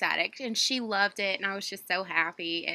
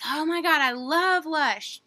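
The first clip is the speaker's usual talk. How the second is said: imitating a gushing fan, higher and louder; gushing excitement; 6 semitones higher, 5 dB louder, slower than usual. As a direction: stretch it out slowly like an excited fan. Clerk gushing about the Lush store.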